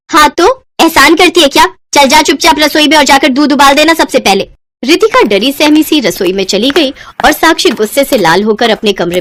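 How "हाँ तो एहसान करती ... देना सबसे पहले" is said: angry scolding. Acted. Babita scolds Ritika.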